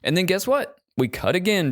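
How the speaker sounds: excited. Real, not acted. Excited movie recap.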